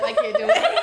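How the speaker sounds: high, loud mock despair; hammed-up panic. Parodying Dean complaining about three weeks left, high mock despair. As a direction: wail it like a doomed hero.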